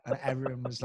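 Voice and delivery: normal talking voice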